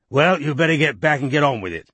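lightly voiced irritable older man; gruff politician. A narrator's reading. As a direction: grumble like an annoyed minister. Chisel voiced in story.

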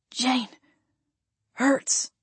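a hurt, fading plea; injured man whimpering in pain. A narrator's reading. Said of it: "he whimpered".

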